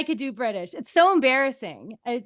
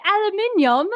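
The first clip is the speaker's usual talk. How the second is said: aluminium in a put-on British accent, higher; imitated British accent; 5 semitones higher than usual. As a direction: copy the British way of saying aluminium. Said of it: "I like the way you all say it".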